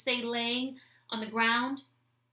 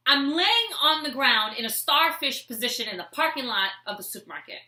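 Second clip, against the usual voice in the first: ordinary talk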